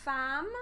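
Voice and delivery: FEMME said as "fam"; correct pronunciation. Shown as a demonstration. Teacher shows a familiar word, femme pronounced "fam". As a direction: give femme an open "ah" vowel. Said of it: "we say femme".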